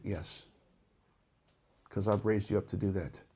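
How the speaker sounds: low, very soft, final answer; quietly firm God. Acted. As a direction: answer hushed, with calm authority.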